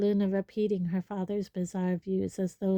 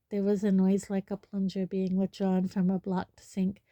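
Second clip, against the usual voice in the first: usual speaking voice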